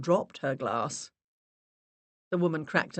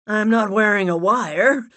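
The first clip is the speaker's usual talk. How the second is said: joking; 5 semitones higher, 9 dB louder than usual. Acted. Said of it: "He attempted to make a joke".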